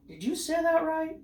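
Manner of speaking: "Did you say that right?" lifted high to the end; high, held question tune on "that right". Acted. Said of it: "you're asking that question".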